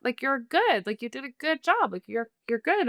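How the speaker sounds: high, overly bright praise; imitating guests pushing enthusiasm; mock gushing approval; forced cheer.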